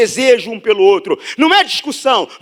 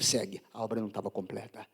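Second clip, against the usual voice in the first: much softer, fast murmured aside; quick aside; 13 semitones lower, 20 dB quieter, faster than usual. Real, not acted. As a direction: toss it off quietly. Aside to reader, hushed. (aparte rápido e baixinho)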